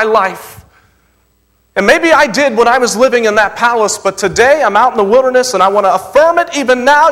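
high, loud pleading prayer voice of David; David praying. Retold or imitated. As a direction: cry out as David in the wilderness. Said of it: "He says".

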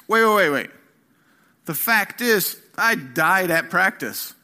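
loud, high repeated wait; loud urgent interruption; emphatic wait. Acted. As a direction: throw up your hands and halt. Stopping the argument emphatically.

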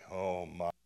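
ordinary talk

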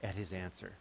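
awed hush. Retold or imitated. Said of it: "we were amazed".

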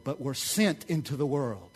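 ordinary talk

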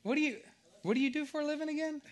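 high, loud disbelief at the amount; can't believe it, pitch shooting up; playful shock at a big gift; surprised. Real, not acted. Anthony gave fifty bucks.

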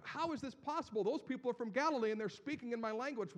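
high, loud amazed exclaiming; a stunned foreigner in the crowd crying out; astonished crowd. Acted. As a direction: marvel that they hear their own tongue. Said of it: "And they're going".

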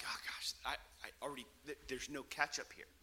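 sheepish whisper so she won't hear; hushed aside. Retold or imitated. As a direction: mutter it under your breath, caught out. Caught out when she comes around.

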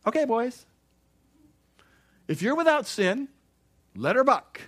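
high, loud, folksy challenge to the accusers; playful Jesus voice. Retold or imitated. Pastor voicing Jesus daring the accusers, high and loud. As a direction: toss the dare out like a grinning cowboy.

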